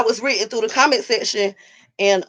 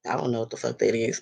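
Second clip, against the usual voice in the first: quiet aside; 11 semitones lower, 9 dB quieter, faster than usual. Retold or imitated. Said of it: "and I'm like".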